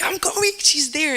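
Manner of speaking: thrilled she is there; excited rush. Retold or imitated. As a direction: squeal with joy at spotting a friend. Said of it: "I was like".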